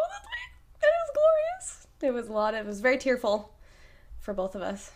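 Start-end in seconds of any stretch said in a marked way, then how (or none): 0.1-1.8 hard to hear. Real, not acted. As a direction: voice climbing into a tiny high squeak. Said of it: "didn't hear what she said".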